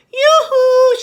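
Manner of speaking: high, loud calling out; shout. Acted. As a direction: call across the pond. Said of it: "she shouted".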